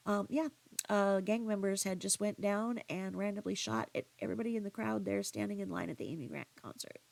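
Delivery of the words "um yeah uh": ordinary talk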